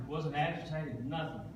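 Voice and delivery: ordinary talk, nothing marked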